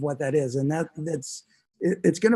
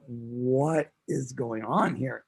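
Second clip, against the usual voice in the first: slow, low, quiet disbelief; baffled; 5 semitones lower, 6 dB quieter, much slower than usual. Retold or imitated. Son retelling his bewilderment at dad's out-of-tune song, baffled. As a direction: draw the question out in hushed bafflement.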